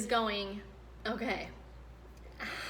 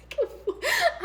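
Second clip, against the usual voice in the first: high laugh; 4 dB louder than usual. Real, not acted. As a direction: burst of high giggling. Laughing mid-question.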